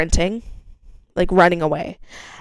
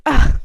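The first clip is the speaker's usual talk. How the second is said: retold gasp; 8 semitones higher, 5 dB louder than usual. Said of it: "I walk up and I'm like".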